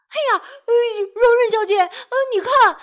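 high, loud alarmed cry; panicked shout; startled panic. Acted. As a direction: yelp in fright looking down. Rope about to snap.

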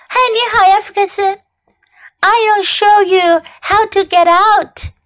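put-on high-pitched child character voice; high storybook kid; Clark, cheerful little brother. A narrator's reading. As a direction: offer help in a high little-boy voice. Child character offers help.

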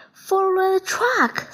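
high, urgent cry; cried out. A narrator's reading. Buzz urging his dad to chase the garbage truck, high excited cry. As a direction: call it out high and eager. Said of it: "cried Buzz".

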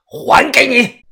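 high, loud fighting yell; explosive shout. A narrator's reading. Fighter shoulder-slams his opponent, explosive shout. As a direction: roar it as you strike back. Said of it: "口中暴喝道".

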